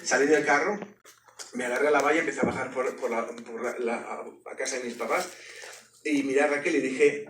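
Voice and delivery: ordinary talk, nothing marked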